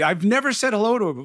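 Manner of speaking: usual speaking voice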